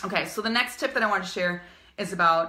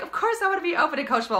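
higher, quick and bright with amusement; laughing client reply; 8 semitones higher, 3 dB louder, much faster than usual. Retold or imitated. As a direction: toss it back fast and amused. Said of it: "They're like".

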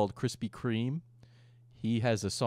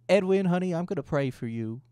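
higher-pitched, sweet Southern belle imitation; [name]'s Southern accent; 8 semitones higher than usual. Imitating Keem's girlfriend promising prayers, Southern accent. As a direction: put on a Southern accent, honeyed. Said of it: "She's got the Southern accent".

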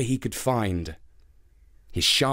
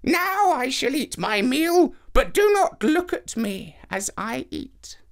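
put-on higher, slow old woman's command; 12 semitones higher than usual. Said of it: "she commanded".

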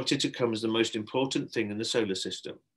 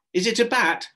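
put-on child guessing; 12 semitones higher, 7 dB louder than usual. A narrator's reading.

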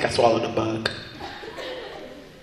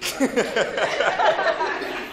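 high laugh; 10 semitones higher, 5 dB quieter than usual. Real, not acted. Laughing at the question.

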